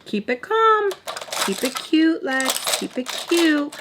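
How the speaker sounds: high, tight self-coaching; forced calm. Retold or imitated. Telling herself to stay cute while angry, forced calm. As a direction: talk yourself down in a high little voice. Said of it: "I'm trying so hard".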